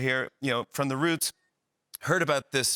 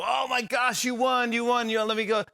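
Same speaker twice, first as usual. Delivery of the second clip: high, rushing mock amazement; gushing concession; 8 semitones higher than usual. Host concedes the game to [name], high and gushing. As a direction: gush it high in one run.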